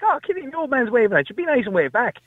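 high-pitched mock parental coaxing. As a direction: lightly act out a parent nudging a little child. Parent prompting small son to wave.

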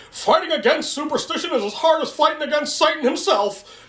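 high-pitched mock indignation; hammed-up flustered preacher. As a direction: sputter it like an offended priest. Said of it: "he gets all flustered going".

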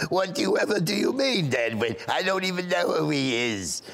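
mock-indignant Boca lady; indignant old lady. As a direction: deny it in character as the elderly caller. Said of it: "And I went".

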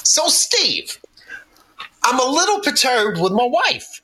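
high goofy coworker voice; imitating Big Fat Jerry. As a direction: ham up the jolly fat driver. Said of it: "This dude goes".

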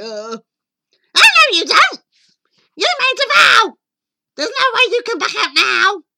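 high, loud screech of protest; screeching ugly sister. Acted. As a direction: shriek to stop him backing out. Ugly sister forbids prince's escape.

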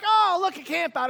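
high-pitched excited discovery, easing off; giddy excitement. Acted. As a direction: act out a giddy traveller spotting a treat. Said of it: "getting all excited and getting all giddy".

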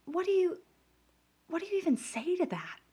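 lost for words at the offer; incredulous. Real, not acted. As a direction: pitch it up in baffled disbelief. Stunned by the director's offer.

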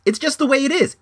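high, exasperated insistence; high-pitched insistence. Real, not acted. Ranting at careless dog owners, high-pitched insistence.

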